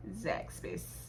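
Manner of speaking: very soft, low near-whisper; a quiet, whispery little aside; hushed. Acted. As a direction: breathe the name out under your breath. Same name said hushed, then sung.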